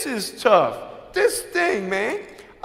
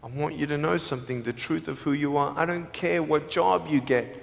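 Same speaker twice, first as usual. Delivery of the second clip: usual speaking voice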